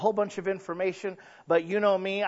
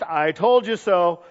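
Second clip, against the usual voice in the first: straight-faced; 10 dB louder, slower than usual. Retold or imitated. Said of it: "Straight-faced".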